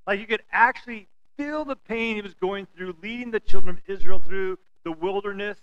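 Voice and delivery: ordinary talk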